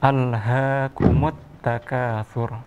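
slow, measured tartil recitation; Quran recitation. Shown as a demonstration. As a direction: recite slowly for a child to repeat. Teacher recites for pupil.

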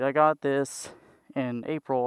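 normal talking voice

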